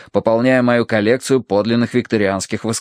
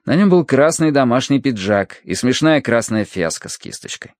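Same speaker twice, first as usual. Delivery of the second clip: ordinary delivery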